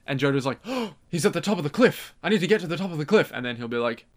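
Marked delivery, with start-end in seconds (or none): 0.7-3.3 excited hero. Acted. Voicing Jojo spotting Dio.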